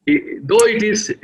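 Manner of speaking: usual speaking voice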